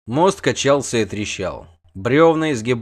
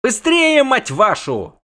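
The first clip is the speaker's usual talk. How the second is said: loud yell, starting very high; cursing yell; 13 semitones higher, 5 dB louder, slower than usual. A narrator's reading. Bard swears at slow rescuers, cursing yell. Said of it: "орал Лютик".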